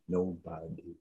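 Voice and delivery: barely breathed nobody; whispered, hollow nobody; near whisper. Real, not acted. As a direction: let nobody fade almost to nothing. Repeating in despair.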